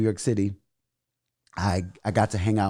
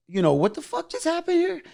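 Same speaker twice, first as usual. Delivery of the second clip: much higher-pitched disbelief; retold indignation, pitched way up; indignant challenge. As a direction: act out an outraged demand. Retelling own indignant challenge.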